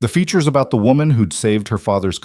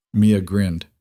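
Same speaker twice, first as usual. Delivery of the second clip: plain reading voice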